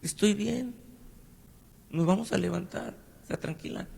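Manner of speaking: sick husband reassuring his wife, high and hushed; retold reassurance. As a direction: soothe her, lifting the pitch and hushing down to nothing. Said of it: "Le digo".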